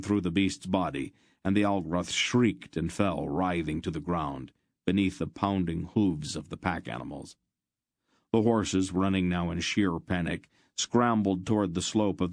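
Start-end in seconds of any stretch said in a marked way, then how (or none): none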